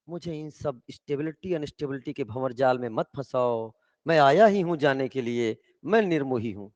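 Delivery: low, restrained refusal; detached renouncer. Acted. Departing son refuses family's web of stability, detached. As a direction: answer low, soft at first, untouched by the pleading.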